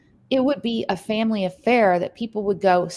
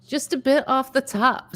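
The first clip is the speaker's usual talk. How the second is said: mock barber joke; 7 semitones higher, 3 dB louder than usual. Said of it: "says [name]".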